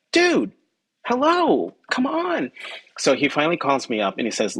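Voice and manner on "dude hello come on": high-pitched mock exasperation, dude hello come on; much higher, fed-up pleading; exasperated. Reporter frustrated at boss dodging salary, exasperated high whine. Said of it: "And I was like".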